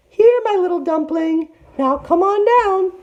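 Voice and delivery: very high, loud sugary call; calling sweet auntie. A narrator's reading. Tiger to Big Sister up the tree, sweetly impatient.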